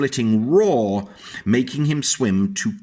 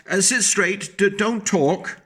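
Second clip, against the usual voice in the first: strict teacher; 8 semitones higher than usual. A narrator's reading. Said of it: "telling the class".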